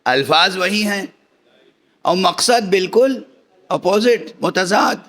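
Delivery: ordinary delivery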